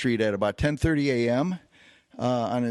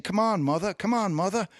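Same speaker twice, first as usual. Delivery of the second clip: mocking higher taunt, repeated; taunting bait; 7 semitones higher than usual. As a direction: goad him like a street fighter spoiling for a fight. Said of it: "kind of baiting me".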